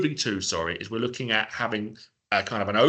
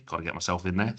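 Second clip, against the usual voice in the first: ordinary talk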